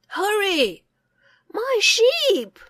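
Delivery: high, loud anxious call; urgent, fearful shout; a villager crying out for the sheep; panicked cry. A narrator's reading.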